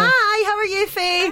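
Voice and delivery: high, over-bright radio greeting; bubbly, put-on radio cheer; very happy. Real, not acted. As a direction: greet them like you've had too much coffee. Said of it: "you sound like you're either very happy".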